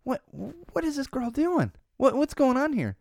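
high, slow disbelieving voice; what is going on here, baffled; incredulous. Retold or imitated. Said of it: "I could tell she was like".

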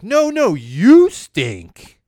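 put-on loud, high playground comeback from the other kid. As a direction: shout it back like a second grader. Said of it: "And the other kid's like".

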